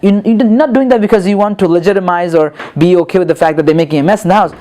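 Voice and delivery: ordinary talk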